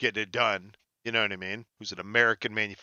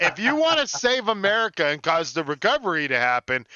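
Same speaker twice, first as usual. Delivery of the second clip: louder, higher, over-the-top laughing pitch; mock grand hype; 8 semitones higher, 4 dB louder than usual. Host hyping Tesla to his co-host as a joke, mock grand. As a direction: build it up like a ridiculous promise.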